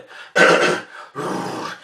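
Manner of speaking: long rough throat clear. Real, not acted. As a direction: clear your throat to get ready to sing. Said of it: "wait, I can sing this".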